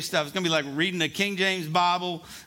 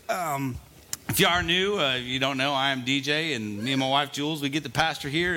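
ordinary talk

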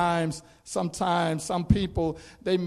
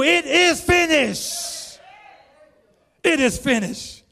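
final words; 9 semitones higher than usual. Acted.

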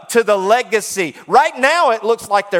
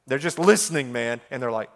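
ordinary talk, nothing marked

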